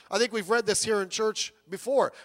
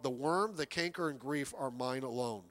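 hushed mournful quote; 8 semitones lower, 9 dB quieter than usual. Retold or imitated. Quoting dying Lord Byron.

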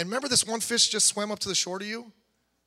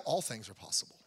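"all things are possible" said soft and lower, falling away; soft, low echo; 9 semitones lower, 9 dB quieter than usual. Shown as a demonstration. Pastor to congregation, quietly echoing "all things are possible".

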